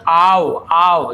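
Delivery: drawn out आओ आओ as two vowels, the wrong way; wrong reading. Shown as a demonstration. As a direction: slowly stretch आओ as आ-ओ. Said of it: "जो कि गलत है". (गलत उच्चारण, आओ खींचकर)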